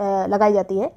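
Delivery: ordinary delivery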